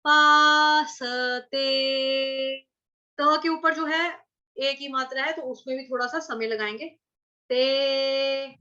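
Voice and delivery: पासते drawn out, lingering on ते; stretched ते. Shown as a demonstration. Teacher models lingering on ते in पासते. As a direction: hold the ते a moment longer. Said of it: "इस तरह से".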